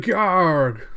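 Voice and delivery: loud, high monster yelp of pain; pained yell. Retold or imitated. Cyborg yelling as Alita spears his eye, pained yell. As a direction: howl as the eye gets speared. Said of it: "He's like".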